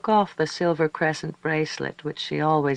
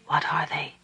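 hushed, voiceless whisper; 3 dB quieter than usual. A narrator's reading. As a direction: whisper it under your breath. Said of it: "said in a whisper".

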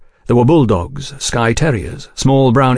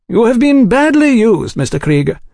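much higher, consoling gentleman's voice; sympathetic gentleman; 12 semitones higher, 3 dB louder than usual. A narrator's reading. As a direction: soothe the bitter man politely. Oliphant voiced by narrator.

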